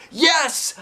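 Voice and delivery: put-on high child's yell; loud high kid voice. A narrator's reading. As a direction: shout it out as a little girl. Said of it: "I yelled".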